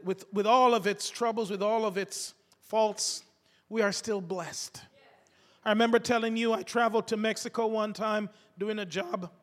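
ordinary delivery

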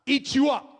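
loud, high burst; sudden shout. Acted. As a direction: bark it out suddenly. Preacher's sudden dramatic cry.

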